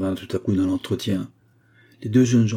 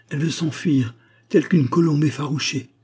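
higher, ardent outcry; exclaiming; 6 semitones higher than usual. A narrator's reading. Valerio crying out in a burst of tenderness, higher. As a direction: cry it out in a rush of tenderness. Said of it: "Il s'écria".

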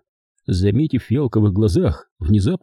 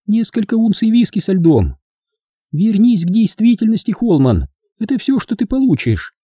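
high female voice, firmly setting limits; cool woman character; 12 semitones higher than usual. Acted. Hilda serves Holman his drink, high and cool woman's voice. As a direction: play a cool, dismissive woman, pitched high.

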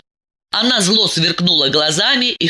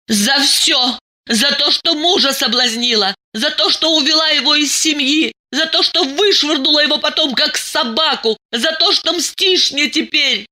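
angry accusation; 10 semitones higher than usual. Acted. Diana lists grievances.

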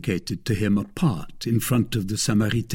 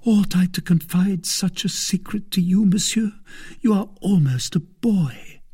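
put-on lightly raised, hesitant young woman's voice; a hint of a worried young wife, higher; anxious young woman; 10 semitones higher than usual. A narrator's reading. Young woman doubting the eager boy, lightly voiced higher and anxious.

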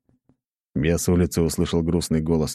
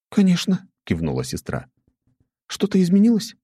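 put-on young woman voice; 13 semitones higher than usual. A narrator's reading. Nastya voiced.

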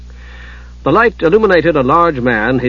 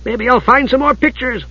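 high, excited kender voice; eager announcement; 10 semitones higher than usual. A narrator's reading. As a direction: chirp hopefully, pitched way up. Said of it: "Tass announced".

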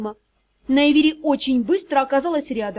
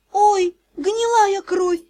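high, slow groan; moaning dismay; 6 semitones higher, much slower than usual. A narrator's reading. Sister appalled by the row, moaning. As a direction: moan it out in dismay. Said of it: "простонала Найвери".

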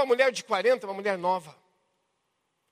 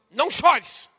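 loud, forceful command; stern order; 7 dB louder, slower than usual. Shown as a demonstration. Preacher showing how Jesus could have commanded the widow, sternly. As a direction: bark it out as an order. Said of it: "Isso é uma ordem".

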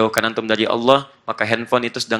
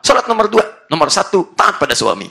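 insistent husband; 6 semitones higher, 4 dB louder than usual. Acted. Husband overrules wife wanting prayer.

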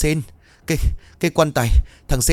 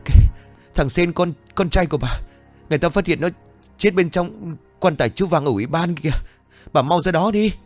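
high, pressing and rushed; urgent breathless report; 8 semitones higher than usual. A narrator's reading. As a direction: urge her to come now. Urgent death news. (gấp gáp, giọng cao)

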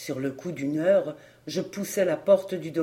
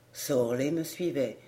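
plain reading voice

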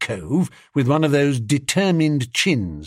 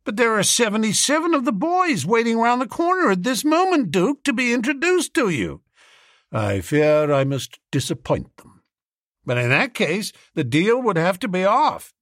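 high-pitched businessman urging the duke; protesting businessman; 9 semitones higher than usual. A narrator's reading.

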